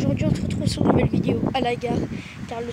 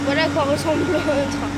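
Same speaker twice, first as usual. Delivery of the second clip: loud announcement; 2 semitones higher, 6 dB louder than usual. Real, not acted. Shouting over passing train.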